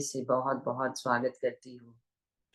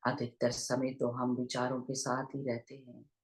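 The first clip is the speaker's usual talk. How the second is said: unhurried meditation guidance with long pauses; guided meditation; 3 dB quieter than usual. Real, not acted. Teacher leading online listeners into breath awareness, guided meditation voice. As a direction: guide listeners gently into their breath, leaving long silences.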